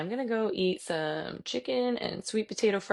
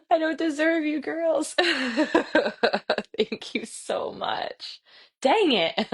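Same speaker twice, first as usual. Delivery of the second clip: higher, with real laughter, the thanks stretched out very slowly; teary laughing thanks; 7 semitones higher, much slower than usual. Real, not acted. Instructor overwhelmed by a student's praise, teary laugh. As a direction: laugh and nearly cry through the thank-you.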